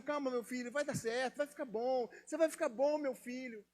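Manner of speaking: put-on soft, tender hush to a sick child; soothing mother. Mother comforting her sick son in her arms, soft and tender. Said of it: "acalentando, dando carinho".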